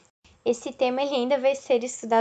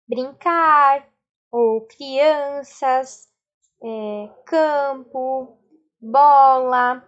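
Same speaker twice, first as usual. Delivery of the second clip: 1 slowly listing words; 4 dB louder, much slower than usual. Shown as a demonstration. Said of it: "Se fôssemos atribuir uma só palavra".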